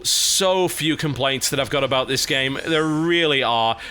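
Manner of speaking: normal talking voice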